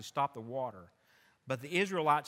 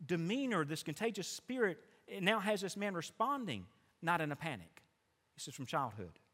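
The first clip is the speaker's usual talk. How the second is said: normal talking voice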